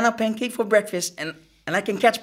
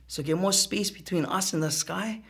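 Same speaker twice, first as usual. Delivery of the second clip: ordinary delivery, nothing marked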